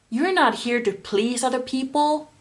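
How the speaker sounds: ordinary talk, nothing marked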